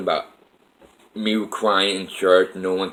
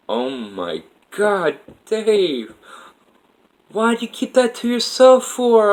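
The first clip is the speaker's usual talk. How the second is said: Kathy's voice; 13 semitones higher, slower than usual. Retold or imitated. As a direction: lift way up into her voice, hurt she was left out. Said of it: "I hear Kathy's voice like".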